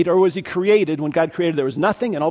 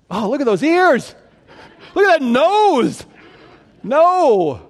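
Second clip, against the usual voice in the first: higher and louder, picky complaint with a drawn-out nose; hammed-up rejection of the elephant; fussy Adam; 8 semitones higher, 5 dB louder, slower than usual. Adam rejects the elephant as a helper, a higher fussy voice. Adam turning down each animal.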